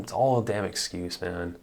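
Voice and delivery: ordinary delivery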